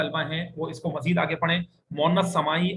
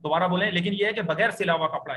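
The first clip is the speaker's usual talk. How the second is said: ordinary talk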